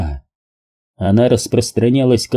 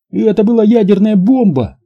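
much higher squealed cry; panicked squeal; 10 semitones higher, 4 dB louder than usual. A narrator's reading. Alex reacting to the nuclear blast, squealing. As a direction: squeal it out in alarm. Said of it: "взвизгнул Алекс".

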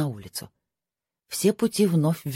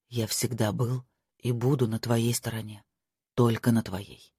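put-on hushed, lowered young lord; lower, softer male voice; 4 semitones lower, 4 dB quieter than usual. Marko voiced by narrator.

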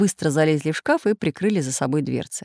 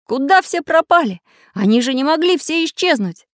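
high, louder cry of dismay; alarmed outcry; 9 semitones higher, 5 dB louder than usual. A narrator's reading. Craftsman searching for the missing children, crying out in alarm. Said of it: "закричал Самоделкин".

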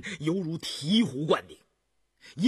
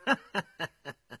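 cackling, scornful laugh; cold chuckle. A narrator's reading. Adviser scoffs at the two princes, cold cackle. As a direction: chuckle coldly in a run of short bursts. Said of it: "乌思道咯咯冷笑".

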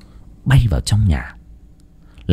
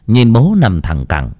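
usual speaking voice

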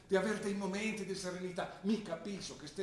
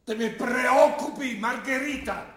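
loud, slow, pleading cry of a worried father; a father shouting his fear at his daughter; 7 semitones higher, 13 dB louder, slower than usual. Acted. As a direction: call her name out in alarm.

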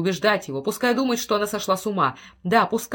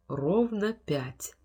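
slow echo; 5 dB quieter, much slower than usual. A narrator's reading. Clock chimes, slow echo.

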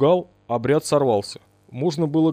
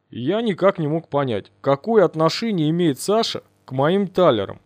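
ordinary delivery, nothing marked

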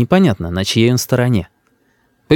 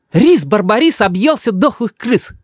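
high, teasing retort; high-pitched exasperated mother; mother losing patience; 10 semitones higher than usual. A narrator's reading. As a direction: snap back with a rhyming taunt. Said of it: "не выдерживает мама".

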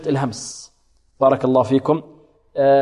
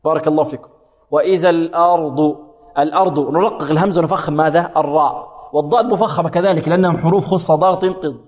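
ordinary delivery

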